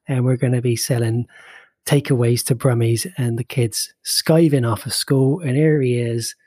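lightly put-on Bristol Somerset accent; a gentle West Country Bristol lilt. Host opening the podcast in a light Bristol Somerset accent. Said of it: "this, of course, is me doing my very best Bristol, Somerset accent".

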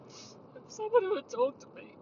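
squeaky high pleading repeat; high whiny wail. Acted. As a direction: whine it up high like a kid.

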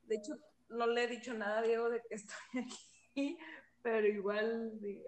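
ordinary talk, nothing marked

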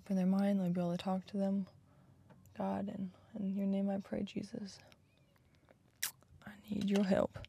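hushed, quick murmured prayer; whispered prayer. Real, not acted. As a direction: pray under your breath, barely voiced. Whispered prayer before approaching strangers.

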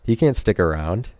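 normal talking voice

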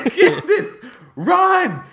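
high, frantic mock yelp; retold panicked cry. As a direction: call out in comic desperation. Retold panicked warning, repeated.

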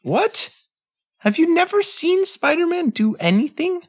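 high, disbelieving what; shocked disbelief at the answer; incredulous. Real, not acted. Incredulous reaction to Batman answer.

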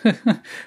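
high nervous chuckle; a quick uneasy laugh. Acted. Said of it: "lachte nervös".

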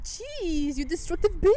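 high, loud playful telling-off; mock scolding. Real, not acted. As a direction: scold your friend in exaggerated outrage. Mock scolding of friend in story.

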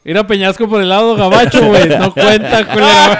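high, loud teasing call; loud mocking taunt. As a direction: shout the taunt at a friend.

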